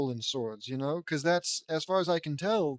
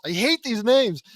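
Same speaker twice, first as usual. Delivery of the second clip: loud, higher-pitched frustrated aside; fed-up reader's loud complaint; exasperated outburst; 6 semitones higher, 9 dB louder than usual. Real, not acted. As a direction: burst out in exasperation. Reader vents at the names.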